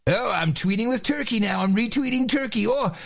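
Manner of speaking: high-pitched mock panic; mocking Andy Carvin. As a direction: whine it in a high, silly caricature. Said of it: "what's the douchebag from N P R?".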